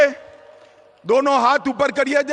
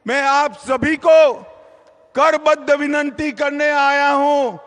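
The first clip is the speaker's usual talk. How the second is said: usual speaking voice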